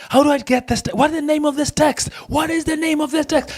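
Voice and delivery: high-pitched mock pestering questions; fretting learner. Teacher imitating a learner hunting for a font, high mock fretting. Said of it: "And then again".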